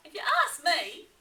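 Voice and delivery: lightly voiced know-it-all Platypus. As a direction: put in your view as Platypus. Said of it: "said Platypus".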